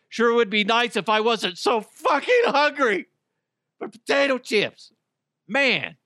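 high, whiny mock complaint; mock whiny lament. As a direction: whine it like a sulking snacker. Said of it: "you're like".

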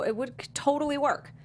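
normal talking voice

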